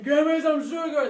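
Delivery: loud greedy monster voice; greedy plaque demand. Acted. Plaque begging for sugar, loud greedy demand. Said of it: "instead of saying".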